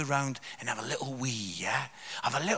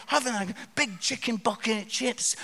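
ordinary talk

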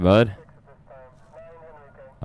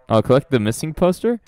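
ordinary talk, nothing marked

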